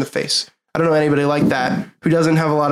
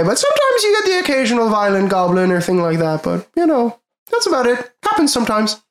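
high-pitched ship captain sliding into a light Irish lilt; high, slightly Irish captain; 5 semitones higher than usual. Acted. Game master voicing the captain to the party, high and a little Irish. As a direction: play the cheerful captain high with a hint of Irish. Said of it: "high, and then it sorta slipped into, like, a little Irishy".

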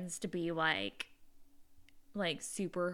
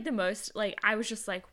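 ordinary talk

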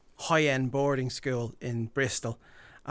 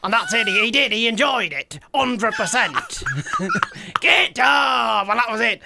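higher, louder, playful Tyson Fury voice; Tyson Fury impression; 7 semitones higher, 5 dB louder than usual. Impressionist telling hosts about Fury, in the boxer's voice. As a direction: slip into the boxer's voice and laugh through it. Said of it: "when you mention a name, I've gotta go into it".

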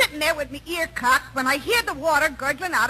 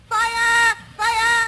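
raised-pitch mock alarm call; hollering; 6 semitones higher than usual. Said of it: "I hollers".

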